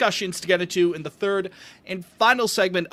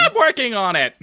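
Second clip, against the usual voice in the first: mocking imitation; 6 semitones higher, 4 dB louder than usual. Mocking Jake's line.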